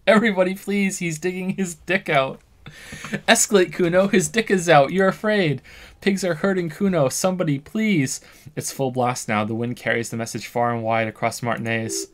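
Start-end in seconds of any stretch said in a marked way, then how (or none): 0.1-8.2 higher, loud hammed-up yelling; mock cries for help. The kids accusing the cop to the neighbours, loud fake panic. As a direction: scream accusations to the whole street.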